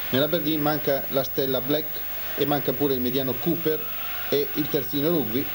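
ordinary talk, nothing marked

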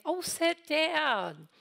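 high, slow, gentle "oh, sit down"; mock tender husband. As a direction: coo it like a doting pastor husband. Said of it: "if you walk in and say".

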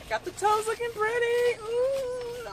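high, squealing with delight; excited squeal. Real, not acted. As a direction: gush about pretty toes. Happy after spa.

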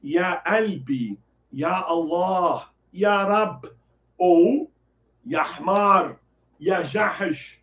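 "ya Albi, ya Allah" said slowly; slow Arabic examples. Shown as a demonstration. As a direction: spell out "ya Albi, ya Allah" at half pace. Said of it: "Então você pode botar".

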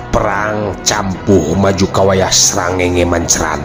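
ordinary delivery, nothing marked

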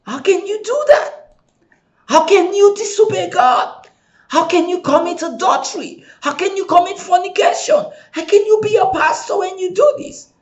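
high, indignant scolding, rising to a shout; hammed-up self-righteous blame; mock accusing. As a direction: pitch up high, accusing each question. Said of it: "I used to blame them".

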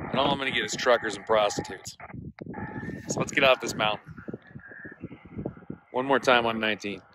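normal talking voice

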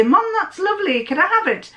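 put-on high little girl's pleading; daughter voice. Daughter in the shop asking mum, high pleading.

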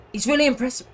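a tentative attempt at a pundit impression; Gary Neville. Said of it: "if I can do a Gary Neville impression".

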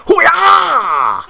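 very high, loud whoop; triumphant shout. Real, not acted. Gamer whooping after a huge hit, loud triumphant shout. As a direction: let out a war cry.

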